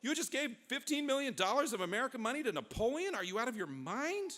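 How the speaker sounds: high-pitched outraged scolding; furious Congress. Acted. Congress confronting the president over the money, furious and high-pitched. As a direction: demand an answer in indignant disbelief. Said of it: "Congress was furious".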